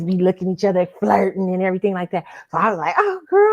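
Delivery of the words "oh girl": put-on high, loud girlfriend squeal of interest; teasing excitement.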